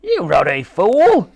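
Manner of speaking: loud, high, slow furious outburst; raging. A narrator's reading. The Admiral berates Titch for the misfire, raging. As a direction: rage at him, stretching the words. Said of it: "raged the Admiral".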